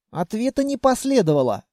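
ordinary delivery

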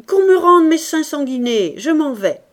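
loud, high protest easing slightly at the end; indignant demand. A narrator's reading. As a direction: demand it over the uproar. Member demanding guineas in the uproar. (réclamation indignée, voix haute et forte)

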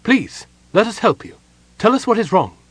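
high, urgent, worried plea; panicked pleading. A narrator's reading. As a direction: beg to be allowed to help. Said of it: "She sounded panicked".

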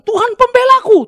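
loud, high-pitched mocking proclamation; hammed-up, high and loud boast; mock self-righteous claim. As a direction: proclaim it loudly, imitating a false believer. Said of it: "terus bilang".

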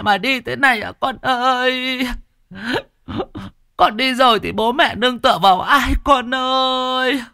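high, keening funeral lament; wailing mourner. A narrator's reading. Grieving parent wails over a dead son at the funeral, high keening lament. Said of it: "những tiếng khóc thấu trời".

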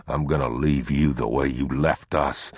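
low, controlled verdict; unmoved man. Acted. Man sentences the thief to be left, unmoved. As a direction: state it low and finally.

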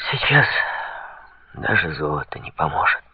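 low, soft, resigned sigh; hushed sigh, voice dropping away; sighed words. A narrator's reading. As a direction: let it out on a hopeless breath. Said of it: "вздохнул Жан".

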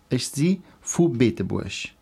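usual speaking voice

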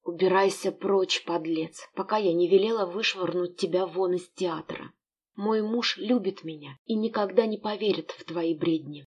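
plain reading voice

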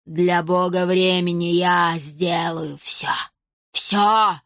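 slow vow rising to a cry; fanatical old woman. A narrator's reading. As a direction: stretch it out, then punch the last word. Villainess declares her devotion. (протяжная клятва старухи, под конец выкрик)

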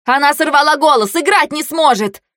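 high, urgent report; alarmed cry. A narrator's reading. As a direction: raise the alarm to the others.

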